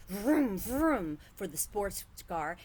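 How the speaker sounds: loud vroom engine sound; car engine noise. A narrator's reading. Boy playing with toy cars, vroom engine noise. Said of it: "making noises with his mouth".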